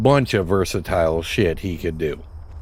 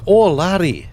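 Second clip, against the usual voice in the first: hammed-up Scottish brogue, pitched higher; mock Scottish dwarf; 5 semitones higher than usual. Mocking how players voice dwarves, hammed-up Scottish accent. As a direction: play the stock Scottish-accented dwarf, jolly and broad. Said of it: "like, an Irish or a Scottish accent".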